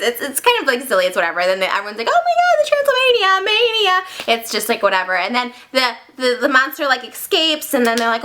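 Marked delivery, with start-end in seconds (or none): 2.1-4.4 very high, squealed crowd reaction; mock excitement. Mocking everyone's excited reaction.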